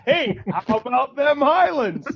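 loud, slow hammed-up Scottish accent; mock Scottish accent. Joking back at a bad impression, loud hammy Scottish accent. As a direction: belt it out like a Highlander in a Scottish accent. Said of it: "your Scottish was, like, in my head".

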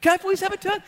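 put-on high insistent kid voice; pleading little boy. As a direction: imitate a child pestering for a go. Dad voices son begging again.

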